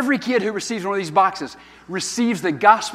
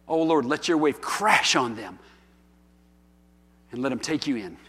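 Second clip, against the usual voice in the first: earnest prayer. Acted. Closing prayer.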